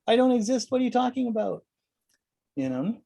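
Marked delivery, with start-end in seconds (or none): 0.1-1.6 high, alarmed lizard-brain voice; shrill panicked little voice; panicked ego. Retold or imitated. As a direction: squeal like a frightened ego. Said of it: "The lizard brain of your ego is like".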